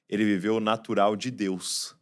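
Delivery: ordinary talk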